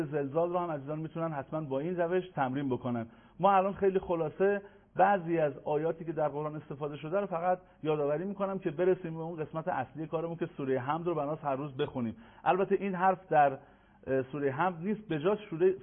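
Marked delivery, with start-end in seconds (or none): none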